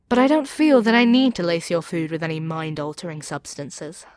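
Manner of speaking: ordinary talk, nothing marked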